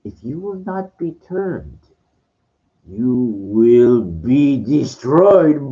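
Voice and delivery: slow, drawn-out sinister threat; menacing Emperor. Retold or imitated. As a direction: stretch each word, pure menace. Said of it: "He goes".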